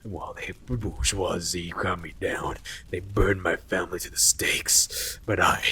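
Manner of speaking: grand and bitter, whispering the vow; self-righteous plant. Retold or imitated. Bob LaPlante, the self-righteous plant, vowing revenge. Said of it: "spoke with a very self-righteous".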